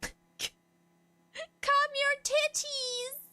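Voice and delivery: high, slow, drawn-out teasing cry; mock yell. Real, not acted. Streamer telling chat to calm down, high slow teasing cry. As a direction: drag it out like a silly command. Reacting to a funny chat comment.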